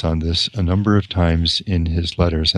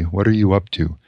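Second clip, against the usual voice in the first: usual speaking voice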